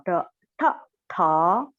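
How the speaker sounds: ta said with the tongue curled back; teacher demo of curled-tongue ta; retroflex ta demo. Shown as a demonstration. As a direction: curl the tongue and say ta, ta, ta. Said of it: "try to pronounce the T sound".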